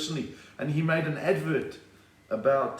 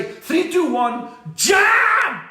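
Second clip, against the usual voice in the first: high-pitched yell building to JUMP; shouted countdown; 13 semitones higher, 9 dB louder, much slower than usual. Acted. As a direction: holler the command to a horse. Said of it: "you gotta say".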